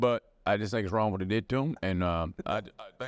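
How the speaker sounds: very low, hesitant Southern voice; deep Southern baritone. Acted. Deep-voiced Southerner cautiously siding with them, deep baritone. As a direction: trail along in a deep baritone. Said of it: "when they have that deep baritone".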